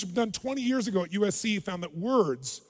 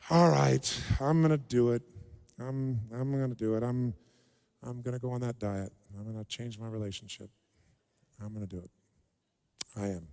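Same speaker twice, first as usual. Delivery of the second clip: sinking from low to much lower and softer; a half-hearted vow trailing into nothing; unconvincing resolve; 9 semitones lower, 6 dB quieter than usual. Shown as a demonstration. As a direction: fade out as the promise dies. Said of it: "You aren't doing squat".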